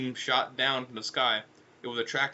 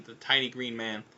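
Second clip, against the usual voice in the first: ordinary delivery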